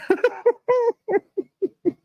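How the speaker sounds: squealing laugh after the parody; high burst of laughter. Real, not acted. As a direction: let out a high helpless laugh. Laughs after mocking the girlfriend.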